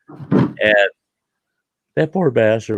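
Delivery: ordinary delivery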